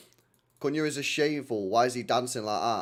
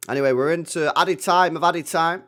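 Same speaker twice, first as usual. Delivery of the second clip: loud, slow half-time score call; mock announcer; 4 semitones higher, 9 dB louder than usual. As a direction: boom it out like a stadium announcer.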